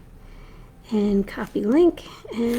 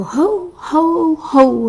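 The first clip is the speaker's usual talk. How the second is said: jolly ho ho ho; 6 semitones higher, 6 dB louder, much slower than usual. Acted. Mrs Claus greeting again.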